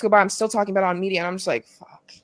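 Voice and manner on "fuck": barely audible deflated curse; muted resignation. Retold or imitated. Daughter still talking about him on media, quietly resigned. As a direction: let it out almost silently. Weary reaction to being let down again.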